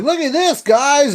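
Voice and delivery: high, proud show-off call; proud excited toy voice. Retold or imitated. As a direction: show it off to the guys, high and chirpy. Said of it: "holding it up like".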